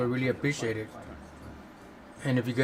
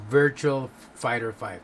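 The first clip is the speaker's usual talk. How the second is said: Virtua Fighter five said slowly and carefully, each word spelled out; slower than usual. Shown as a demonstration. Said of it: "do it out slow motion".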